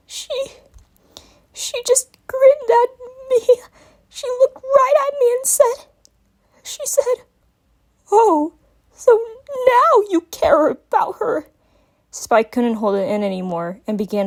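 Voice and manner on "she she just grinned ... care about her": high, slow, trembling sob; words breaking apart; cracking voice. A narrator's reading. Young dragon tells Twilight what the villain said, voice cracking. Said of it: "speak through his cracking voice".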